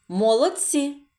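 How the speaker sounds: ordinary talk, nothing marked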